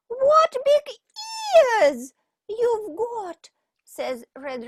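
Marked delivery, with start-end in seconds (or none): 0.1-3.5 put-on much higher, louder girl; amazed girl. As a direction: gasp it out wide-eyed. Girl voiced.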